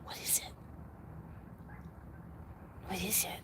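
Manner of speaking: soft unvoiced whisper; real hushed whisper to the dog. Real, not acted. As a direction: whisper so no one wakes.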